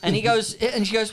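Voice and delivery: ordinary talk, nothing marked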